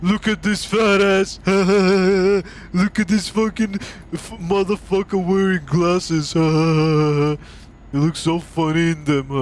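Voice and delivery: hammed-up taunting and fake guffaws; mocking bully. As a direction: imitate kids ridiculing someone's looks. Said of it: "people were, like, body shaming you".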